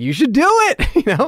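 mock-fanboy encouragement; eager urging. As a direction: cheer him on. Urging star back onstage.

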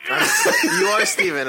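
laughing through the words; giggly. Real, not acted. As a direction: caught in a fit of giggles. Laughs over the mix-up.

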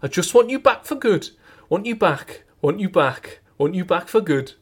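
Welsh lilt over the chorus, pitch lifted; Welsh accent. Pretend. Singing the Take That chorus in a Welsh accent. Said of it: "You go Welsh".

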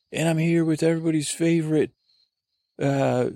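high, fast, peppy host voice; excited voice. Acted. Host announcing his co-star in excited voice. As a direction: sell it like a game-show host. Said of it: "I was using my excited voice".

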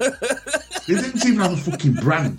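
high, laughing disbelief. Real, not acted. Telling mates about cheap trainers, laughing. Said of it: "laughing while telling".

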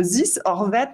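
put-on slow, English-accented "this or that". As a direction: say it like an English game host. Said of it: "un accent anglais".

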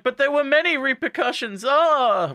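very high, hammed-up wail; mock despair. Teacher to Blondie about repercussions, hammed-up despair. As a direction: wail it up high, ending in a loud cry.

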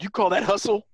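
high coach bark, lightly voiced; barking gym coach. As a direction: call out a slacking player like a coach. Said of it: "the zombie coach shows up and is like".

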